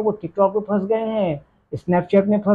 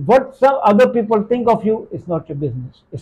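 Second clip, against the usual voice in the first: slow deliberate maxim; much slower than usual. Shown as a demonstration. Teacher states favourite rule slowly.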